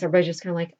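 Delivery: ordinary delivery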